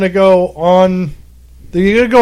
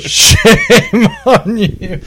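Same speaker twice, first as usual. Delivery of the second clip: laughing; 7 semitones higher, much slower than usual. Real, not acted. Laughing scolding.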